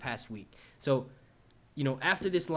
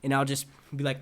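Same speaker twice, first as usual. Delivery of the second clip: ordinary talk, nothing marked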